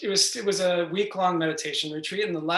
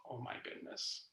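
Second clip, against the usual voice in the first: awed whisper; 6 semitones lower, 19 dB quieter than usual. Real, not acted. Recalling daring to say my love.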